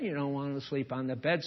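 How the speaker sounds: ordinary delivery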